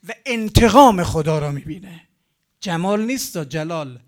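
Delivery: full-voiced preacher's cry; booming climax. Real, not acted. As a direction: hurl the final word out to the crowd. Sermon climax on God's revenge.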